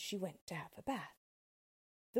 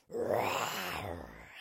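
low, unvoiced growling roar; lion roar; 13 semitones lower, 4 dB louder than usual. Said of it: "he roared".

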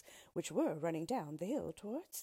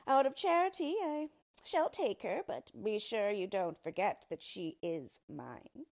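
condescending dwarf; 4 semitones higher, 8 dB louder than usual. Acted. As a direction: lay down terms as a haughty dwarf.

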